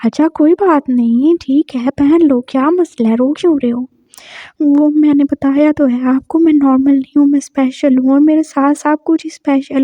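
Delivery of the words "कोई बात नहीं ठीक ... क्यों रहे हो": ordinary delivery, nothing marked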